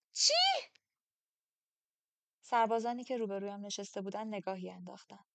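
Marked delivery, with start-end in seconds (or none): 0.1-0.3 startled, high yelp of disbelief; sudden loud astonished question; shocked outburst. Acted. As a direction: blurt it out, stunned. Stunned by his claimed age.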